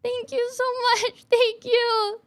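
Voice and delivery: high, squeaky heartfelt thanks; overcome with gratitude. Real, not acted. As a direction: thank them in a tiny, high, overwhelmed voice.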